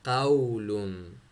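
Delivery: slow क़ौलुन with the mouth wide open. Shown as a demonstration. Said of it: "देखो मुँह यूं खोल के".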